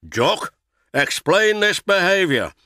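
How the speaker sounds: a boss demanding an answer; stern boss. A narrator's reading. Fat Controller demands explanation.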